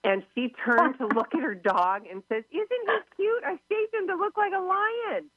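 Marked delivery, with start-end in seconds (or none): 2.5-5.3 high, gushing voice of a proud owner; gushing dog owner. Retold or imitated. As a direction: coo it like a proud pet owner showing off her dog. Client shows off her shaved dog.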